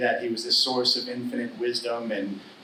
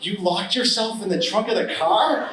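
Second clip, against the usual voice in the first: laughing disbelief; 6 semitones higher than usual. Retold or imitated. Son amazed at father's mishap.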